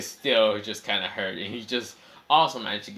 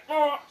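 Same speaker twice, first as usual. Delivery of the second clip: pained high yelp; 4 dB louder than usual. Acted. Itachi hit where it hurts.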